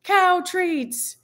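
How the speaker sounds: high, slow eager ask; drawn-out excited request; kid-like plea. Retold or imitated. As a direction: plead it slowly like an excited kid. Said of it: "and is like".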